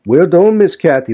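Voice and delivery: high, loud sarcastic "done"; mocking old servant. A narrator's reading. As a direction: jeer at her with a rising "done". Joseph mocks her, first take.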